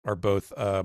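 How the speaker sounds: ordinary talk, nothing marked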